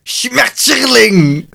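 Schmetterling barked slow and high; harsh German shout. Shown as a demonstration. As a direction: spit out Schmetterling like a curse. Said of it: "alles in Duits klinkt toch als een scheldwoord".

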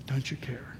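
"Don't you care?" as a hushed whisper; "Don't you care?" whispered, low and intimate. Acted. Repeating the disciples' cry.

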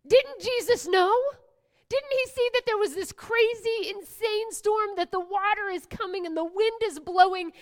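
can you believe it, pressing each question; incredulous. Acted. Preacher asking the congregation how Jesus could sleep, incredulous.

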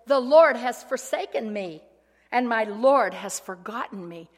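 loud despairing complaint; anguished lament. Retold or imitated. Zion complaining God forgot her, loud lament. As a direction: cry out feeling forsaken. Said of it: "But Zion said".

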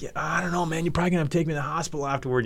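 milk a fake injury; playing it up. Storyteller faking hurt to his rival, acting it up. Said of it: "I'm just playing it up totally".

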